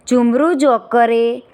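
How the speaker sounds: ordinary delivery, nothing marked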